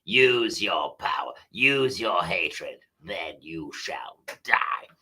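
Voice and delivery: low, slow menacing Sith voice; Darth Vader impression. Host quoting Lord Vader to open the show, slow deep menace. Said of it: "Lord Vader just said".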